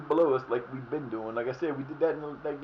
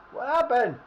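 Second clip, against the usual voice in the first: higher, louder alarmed repeat; 10 semitones higher, 5 dB louder than usual. Real, not acted. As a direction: ask again, now really worried.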